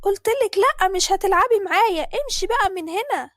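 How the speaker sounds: high, loud angry refusal; big sister yelling no at little sister; bratty child shout. Acted.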